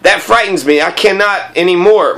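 usual speaking voice

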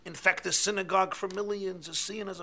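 loud, high fervent sermon voice; impassioned preaching. Acted. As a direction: preach with burning longing. Preaching continues.

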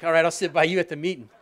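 amused reply to the grumpy greeter; laughing. Retold or imitated. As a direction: chuckle while you answer. Laughing at his own reply.

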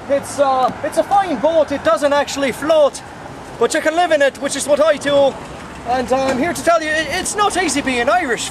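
high-pitched Irish boat seller; put-on Irish accent. Selling a boat to folks, put-on Irish accent. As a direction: sing out the pitch as a mock Irishman. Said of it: "Actually, I'm not Irish".

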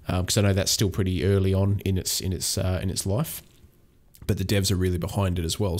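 ordinary talk

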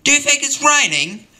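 hammed-up daft digger; silly question voice. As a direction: ask it in a squeaky dopey voice. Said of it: "And he said to me".

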